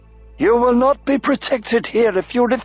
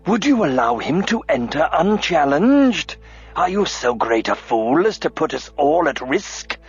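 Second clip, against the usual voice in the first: contemptuous, barely chained rage; raging demand; 5 semitones higher than usual. A narrator's reading. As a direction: tear into him. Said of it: "demanded the bald man".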